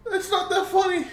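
high-pitched protest through real laughter; laughing. Real, not acted. Gamer denying to friend that it's funny, laughing. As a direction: insist while unable to stop laughing. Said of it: "I'm only laughing".

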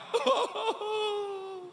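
high, long drawn-out sob; hammed-up weeping; mock crying wail. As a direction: bawl theatrically on a high held note. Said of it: "he began to cry".

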